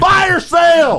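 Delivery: loud, high TV pitchman shout; hard sell ad. Pretend. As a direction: yell it like a used car ad. Fire sale ad parody.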